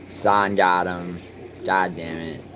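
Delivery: ordinary talk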